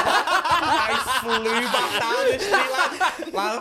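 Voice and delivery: loud laughing telling. Real, not acted. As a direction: tell it through laughter.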